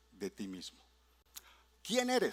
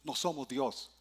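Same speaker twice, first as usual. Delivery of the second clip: usual speaking voice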